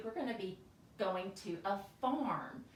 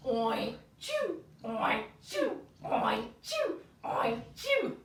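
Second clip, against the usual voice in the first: pig sneeze; 3 dB louder, slower than usual. Shown as a demonstration. Teacher plays a sneezing pig for children, playful oink-sneeze. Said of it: "pig is going to sneeze".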